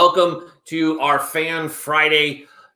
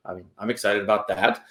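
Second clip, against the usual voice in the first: normal talking voice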